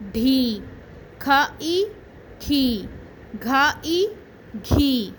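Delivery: put-on teacher sounding out syllables for kids to repeat; syllable drill. Shown as a demonstration. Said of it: "اس کے ساتھ ملا کر پڑھیں گے". (بچوں کو آوازیں جوڑ کر سکھاتی استانی)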